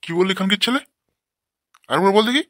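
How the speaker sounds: high, loud furious challenge to the son; angry threat. Acted. Father shouting at his lazy son, furious and loud.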